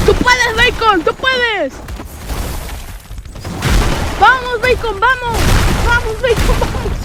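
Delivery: ánimos agudos. Real, not acted. Cheering on teammate Bacon.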